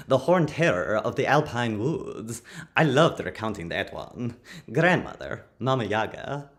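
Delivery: normal talking voice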